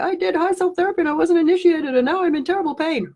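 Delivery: whiny caller. Retold or imitated. Uninitiated client phoning the coach to complain, high mock whine. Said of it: "people phone up and go".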